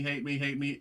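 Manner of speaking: normal talking voice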